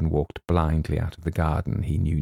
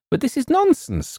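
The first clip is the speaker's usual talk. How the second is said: high-pitched indignant protest; young man's outburst, pitched well up; indignant cry. A narrator's reading. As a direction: cry out in disbelief. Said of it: "cried James".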